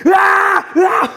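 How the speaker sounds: loud, high-pitched mock scream of fright; acted scared scream. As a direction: scream as if fleeing past graves. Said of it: "무서워가지고 소리를 질러요". (겁에 질린 척 높게 내지르는 비명)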